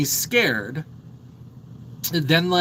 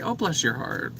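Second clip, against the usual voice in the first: sugary fake politeness; 5 dB quieter than usual. Shown as a demonstration. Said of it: "where you wanna tell somebody to fuck off".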